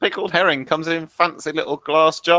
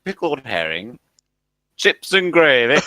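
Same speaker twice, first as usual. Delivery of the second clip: put-on broad northern accent, slow and louder at the end; 5 dB louder than usual. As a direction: read the sign in broad Northern English. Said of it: "written in a northern accent".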